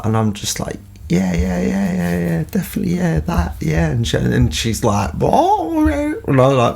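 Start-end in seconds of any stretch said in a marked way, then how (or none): 1.1-3.9 high-pitched string of quick yeses; eager bluffing agreement. Retold or imitated. Acting out bluffing along in French. 5.1-5.7 high-pitched startled question; mock surprise. Voices the French lady's reaction.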